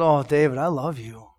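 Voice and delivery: soft, low, gentle love; tender. Retold or imitated. Pastor telling the crying man he loves him, softly. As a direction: say it like a hug.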